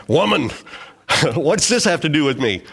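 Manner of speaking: curt, disrespectful address to his mother; mock-rude, dismissive question; rude reading. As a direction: say it as a brusque put-down, half in jest. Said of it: "how could he talk to his mother like this".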